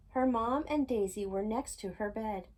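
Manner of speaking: ordinary delivery, nothing marked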